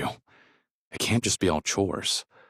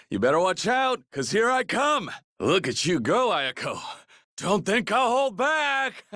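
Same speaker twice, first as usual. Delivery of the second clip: competitive shouting; 12 semitones higher than usual. Acted. Race taunts, shouting.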